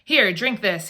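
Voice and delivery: plain reading voice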